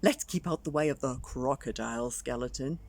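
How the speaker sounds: cautious skeleton; big skeleton caution. Acted. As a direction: warn gently about the crocodile. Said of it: "the big skeleton scratched".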